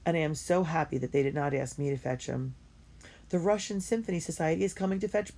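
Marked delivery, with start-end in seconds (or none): none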